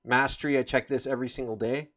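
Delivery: ordinary talk, nothing marked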